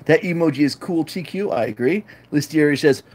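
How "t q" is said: ordinary delivery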